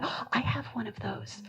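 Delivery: breathy whisper, almost no voice; whispered thought. Retold or imitated. Thinking to herself at the reading, in a whisper. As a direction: let it slip out as a secret whisper to yourself.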